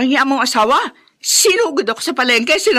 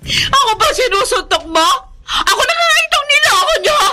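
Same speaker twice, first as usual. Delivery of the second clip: pained sobbing yells; crying shout; 9 semitones higher than usual. Acted. Beaten wife cries out against her cheating husband. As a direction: scream and cry as the blows land.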